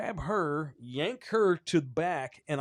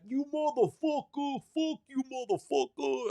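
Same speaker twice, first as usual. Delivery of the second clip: angry yell; 12 semitones higher than usual. Retold or imitated. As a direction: yell insults back from the street. Man yelling from the street.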